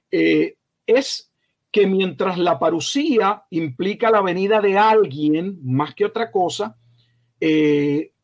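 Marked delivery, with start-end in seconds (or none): none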